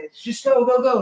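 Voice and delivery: imitated crew hurrying passengers; retold yelling. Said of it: "Why are they yelling?".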